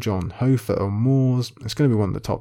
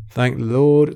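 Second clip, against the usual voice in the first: relieved exclamation; 4 semitones higher, 7 dB louder, slower than usual. Real, not acted. Quizzer thanking the Lord after a close win, loud relief. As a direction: Lord rings out higher and louder.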